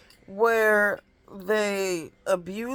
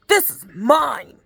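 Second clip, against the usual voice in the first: slow, loud and higher, claiming the toy; bossy toddler; 6 semitones higher, 8 dB louder than usual. Retold or imitated. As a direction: plant your feet like a two-year-old: mine. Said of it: "she'll walk over to him and be like".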